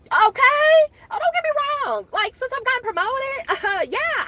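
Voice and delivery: high, squeaky put-on voice; high mock voice. Mocking the pay talk in a high voice. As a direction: play a mock sweet little voice.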